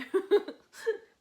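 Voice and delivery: sudden loud, high laugh; short high-pitched cackle of amusement; burst of laughter. Real, not acted. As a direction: burst out laughing at your own remark. Amused by her own remark.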